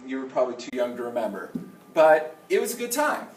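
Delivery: usual speaking voice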